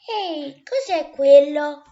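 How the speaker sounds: sudden loud cry of alarm; startled shout. Acted. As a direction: call out in surprise at something strange. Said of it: "urlò ad un tratto Novella".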